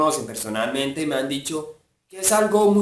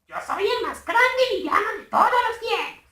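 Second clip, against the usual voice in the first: high, strained attempt at Gru; a first clumsy go at the villain voice; beginner's Gru impression, not there yet; early failed Gru try. Shown as a demonstration. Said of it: "No me salía, o sea, al principio no me salía".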